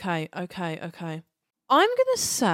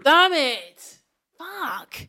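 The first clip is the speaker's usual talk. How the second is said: frustrated outburst; 7 semitones higher, 3 dB louder, much slower than usual. Real, not acted. Frustrated at a wrong answer.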